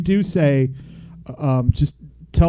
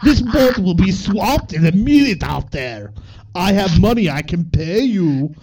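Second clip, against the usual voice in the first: loud, slow mock Russian accent; amateur comic Russian accent; 5 semitones higher, 4 dB louder, slower than usual. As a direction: ham it up as a Russian, pitched high. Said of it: "I can't really do a Russian accent".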